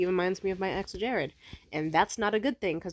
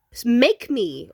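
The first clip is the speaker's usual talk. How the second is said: put-on childish taunt; 5 semitones higher, 9 dB louder, much slower than usual. Taunting like a kid.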